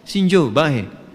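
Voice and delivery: slow, drawn-out Libyan Arabic greeting; lightly imitated Libyan Arabic; Libyan dialect. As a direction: stretch it out like a Libyan local. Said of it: "Orang Libya bilangnya".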